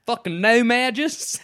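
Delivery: slow, drawn-out Southern twang on "no-mages"; hammed-up Southern drawl; southern accent. Shown as a demonstration. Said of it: "say it with, like, a southern accent".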